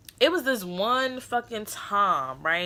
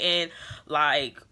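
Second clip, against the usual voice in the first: ordinary delivery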